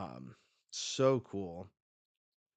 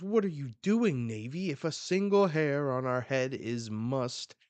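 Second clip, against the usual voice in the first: indignant; 5 semitones higher, 8 dB louder than usual. Acted. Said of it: "And someone says".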